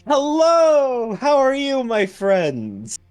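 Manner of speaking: high, loud greeting to customers; big, high-pitched shopkeeper hello; effusive merchant. Acted. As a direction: sing out a delighted welcome to friends. Game master voices greeting merchant.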